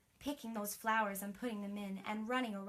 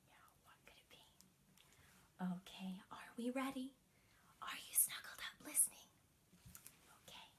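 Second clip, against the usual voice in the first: hushed bedtime whisper; 13 dB quieter, faster than usual. Acted. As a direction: lean in and whisper to the kids. Bedtime story hush to children.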